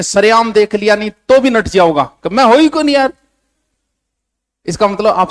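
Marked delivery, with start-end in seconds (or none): 2.3-3.1 high, loud protest of innocence; mock denial. Someone seen in public backs out of it, mock denial. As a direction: deny it outright, pitched up high.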